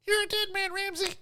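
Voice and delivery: put-on very high, small kid voice for a giant; little kid voice. Bounty hunter threatening Ramsey in a little kid voice. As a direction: squeak a threat like a tiny kid. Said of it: "voiced over the Undertaker with a little kid".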